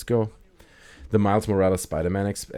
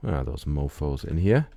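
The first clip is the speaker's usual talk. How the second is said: usual speaking voice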